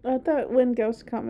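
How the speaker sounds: normal talking voice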